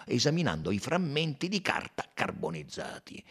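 ordinary delivery

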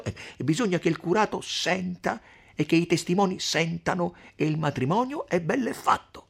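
emphatic telling, building high and loud; scheming mother Agnese. Acted. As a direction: lay out the trick with mounting relish. Agnese explains her scheme.

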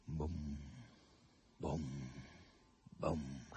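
low, soft, slow bom; mimicked drum beat. A narrator's reading. Narrator echoing the funeral drum, low and soft. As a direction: toll each bom like a distant bass drum. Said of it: "emettendo sempre lo stesso".

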